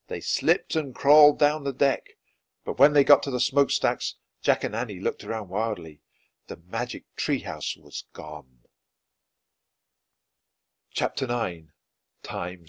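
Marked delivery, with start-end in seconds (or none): none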